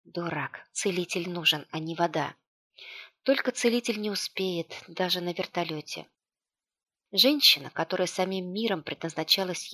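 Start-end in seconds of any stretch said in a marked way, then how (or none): none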